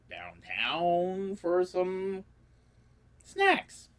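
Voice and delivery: slow, rising to a high squeal on snacks; drawn-out comic build. Pretend. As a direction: stretch it, then squeak the last word.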